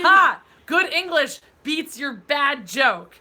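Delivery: loud, high, slow victory crow; gloating triumph. Real, not acted. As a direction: declare victory, drawing out each word. Gloating over beating wordplay joke.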